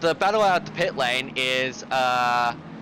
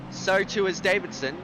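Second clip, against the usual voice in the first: ordinary talk, nothing marked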